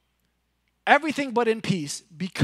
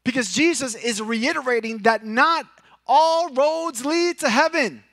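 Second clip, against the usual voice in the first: climactic preaching; 7 semitones higher, 7 dB louder than usual. Acted. Pastor warning that not all roads lead to heaven, preaching at climax. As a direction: lift the voice and press the point.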